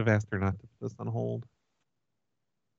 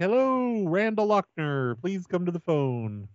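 high, drawn-out holler for a customer; mock page across a room, stretching the words; demonstrated call-out; 5 semitones higher, slower than usual. Shown as a demonstration. Caller shows restaurant worker how to yell around, demonstrated call-out. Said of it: "you just have to yell around".

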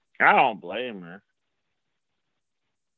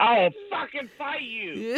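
put-on belligerent drunk. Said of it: "Why are you so angry?".

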